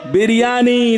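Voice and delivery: ordinary delivery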